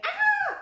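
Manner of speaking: shrill high scream of fright; startled scream. Retold or imitated. Storyteller shows how she screams at a cockroach, loud shriek. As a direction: shriek as if a cockroach surprised you. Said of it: "I say".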